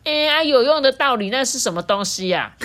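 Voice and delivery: high, loud puzzled raccoon. A narrator's reading. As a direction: ask like a clueless raccoon. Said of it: "心不在焉的浣熊还说".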